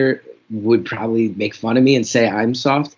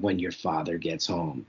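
low, hushed warning of what's coming; mock the mom's dark threat quietly; ominous mom threat; 6 semitones lower, 12 dB quieter than usual. Mom warning the kids about dad, hushed and ominous.

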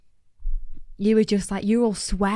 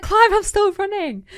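high, loud excited call-out; raised, breathless happy yell; 12 semitones higher, 7 dB louder than usual. Retold or imitated. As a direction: shout it mid-run, thrilled and amazed. Retold surprised call to dad.

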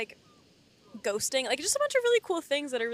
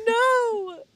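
anguished cry; 12 semitones higher, 9 dB louder than usual. Real, not acted. Reacting to sad sight.

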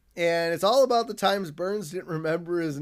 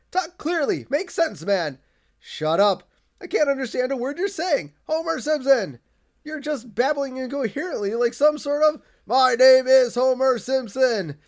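exasperated Burns; 6 semitones higher, 4 dB louder than usual. Retold or imitated. Burns shouting back at Homer.

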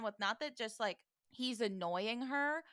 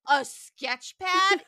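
loud, high, drawn-out disbelief; hammed-up shouted question; mock outraged yell; 9 semitones higher, 13 dB louder, much slower than usual. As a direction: yell it slowly like a scandalous discovery. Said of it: "he yanks it out and yells".

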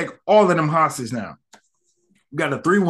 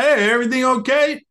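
lightly acted, higher-pitched check-in; retold concerned call; 8 semitones higher than usual. Passer-by checks on captives, mock concern in a higher voice. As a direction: raise the voice as an imitated passer-by.

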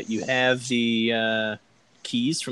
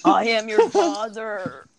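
slow menacing reveal; Darth Vader voice; 10 semitones higher, 6 dB louder, slower than usual. Pretend. Darth Vader quote joke.

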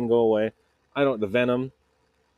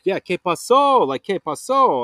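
lightly voiced Mexican neighbor, pitched higher; neighbor in Spanish; 6 semitones higher, 4 dB louder than usual. Neighbor at the door in his underwear asks what happened, lightly voiced in Spanish. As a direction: imitate the sleepy neighbor answering in Spanish, a touch higher. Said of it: "he's like".

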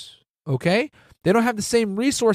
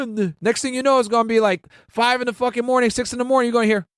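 ordinary talk, nothing marked

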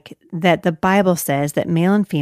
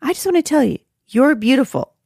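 lightly acted stranger, pitched higher; quoted male stranger; 7 semitones higher than usual. A man complimenting her outside the store, lightly imitated. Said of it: "And he said".

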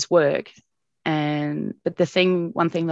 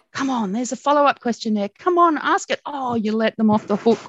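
higher, urging mock-impatient dad; 7 semitones higher, 3 dB louder than usual. Father urging TV reporters to ask follow-ups, higher mock impatience. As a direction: egg the reporter on like an exasperated father. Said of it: "I can hear him saying".

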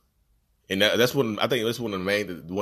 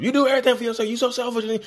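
much higher and louder, hammed-up whine; mock accusation; 6 dB louder, faster than usual. The narcissist accusing you of selfishness, high loud mocking. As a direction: throw the blame back in a squeal. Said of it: "that's how it sounds too".

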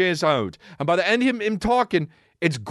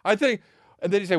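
ordinary talk, nothing marked

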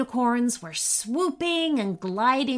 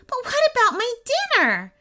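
very high, louder wail of protest; dismayed boy; 14 semitones higher, 5 dB louder than usual. A narrator's reading. Boy protests to the imp about dinner, dismayed. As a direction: plead in a squeaky, upset boy's voice.

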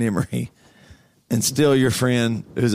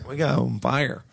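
usual speaking voice

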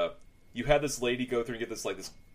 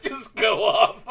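laughing; 13 semitones higher, 5 dB louder, much slower than usual. Real, not acted. Laughing after honking bit.

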